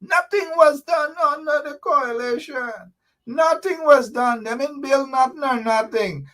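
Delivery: lampoon his words in a put-on voice; mocking a rival. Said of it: "it is Jack Dale who said".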